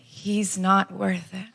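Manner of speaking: soft, very slow reassurance; gentle, drawn-out sympathy; consoling stranger. Retold or imitated. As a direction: imitate a kind stranger's quiet comfort. Stranger consoling her in retold scene.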